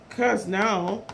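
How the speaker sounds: ordinary delivery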